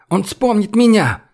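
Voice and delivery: high, desperate insistence; crying out. A narrator's reading. Olga insists he will remember her, crying out. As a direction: cry it out even against his objections. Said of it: "крикнула Ольга".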